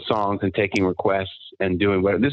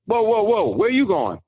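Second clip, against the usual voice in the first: drill sergeant stopping him; 9 semitones higher than usual. Retold or imitated. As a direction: call out a recruit caught sneaking. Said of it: "and he goes".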